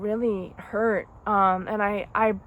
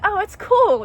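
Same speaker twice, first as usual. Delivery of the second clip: higher, louder mock breeziness; sarcastic mimic; 10 semitones higher, 8 dB louder than usual. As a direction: toss it off in a sarcastic sing-song. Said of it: "I'm not just gonna".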